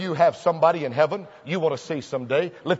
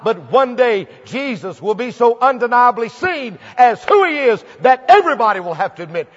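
ordinary delivery

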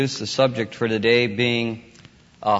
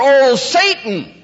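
high, loud fake curse; hammed-up exclamation at full voice; mock oath; 9 dB louder, slower than usual. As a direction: cry it out as if swearing. Mock oath with a substitute name.

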